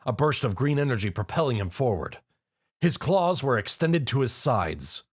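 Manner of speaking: plain reading voice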